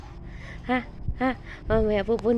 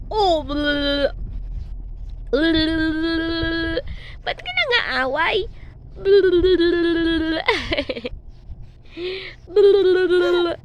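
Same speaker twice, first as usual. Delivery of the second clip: playful bleh; 2 semitones higher, much slower than usual. Pretend. Teasing baby with silly noise.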